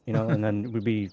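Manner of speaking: normal talking voice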